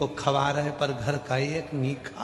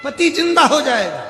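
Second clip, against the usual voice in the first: emphatic repeat; 12 semitones higher, 7 dB louder than usual. Retold or imitated. Guru tempting wife, repeat.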